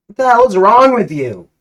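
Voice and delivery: the hero's victim yells at the player in fury; angry exclaim. Acted. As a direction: blast it at him in outrage. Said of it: "Tickle Tipson exclaims".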